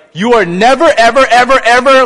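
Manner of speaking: high, loud, emphatic shout of ever; outraged all-caps emphasis; caps lock never. Shown as a demonstration. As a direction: yell it like it's typed in all caps. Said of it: "like caps lock, exclamation point".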